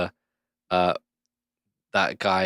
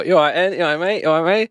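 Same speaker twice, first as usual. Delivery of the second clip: higher, louder chirpy British "You all right, mate?" with rising end; British greeting; 8 semitones higher, 5 dB louder, faster than usual. Shown as a demonstration. Showing a friend how British people greet someone. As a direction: sing out a matey British hello. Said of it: "But British people, you go".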